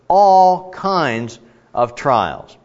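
"all" drawn out slowly, set apart; emphasis on ALL KINDS. Shown as a demonstration. Preacher emphasizing the words for listeners, stressed "all kinds". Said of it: "I'm gonna emphasize the words here".

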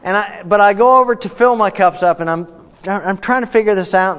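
normal talking voice

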